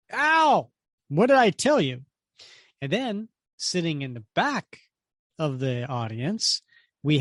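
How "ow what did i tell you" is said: high, loud comic-book "ow"; hammed-up cry of pain turning to reproach; mock yelp and scolding. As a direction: yelp it, then scold in a raised voice. Comic character gets zapped.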